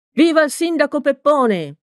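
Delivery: high-pitched rallying cheer; crowd's cheer. A narrator's reading. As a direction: cheer the mayor, voice pitched right up. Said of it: "urlò la folla".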